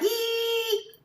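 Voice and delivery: high-pitched "eee" of delight; long thin squeal, imitating her glee; mock excited squeal. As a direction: squeal like a thrilled kid. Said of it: "Like, she's excited".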